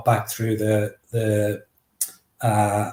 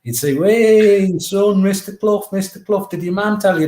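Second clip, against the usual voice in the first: Brian Clough impression; 8 semitones higher, slower than usual. Boy retelling how the football manager Brian Clough spoke, high imitation. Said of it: "he would always go".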